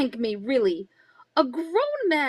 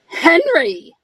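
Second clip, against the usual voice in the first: a louder, accusing bark of his name; sharp call; 3 semitones higher, 10 dB louder than usual. Acted. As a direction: snap his name to stop him cold. Wife catches husband with book.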